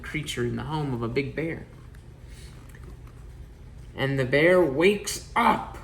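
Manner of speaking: put-on lively rhythmic reading to children; storybook read-aloud. A narrator's reading. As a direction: read it like a bedtime picture book. Picture book read aloud.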